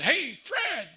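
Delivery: high, loud call of a name; a sharp shout that would scare anyone; sudden yell. Retold or imitated. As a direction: startle him by shouting his name. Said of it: "not yelling, not coming and tapping somebody on the shoulder and saying".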